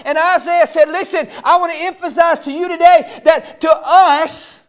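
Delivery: loud, rising sermon voice; preaching at full force. Acted. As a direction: preach it hard to the congregation.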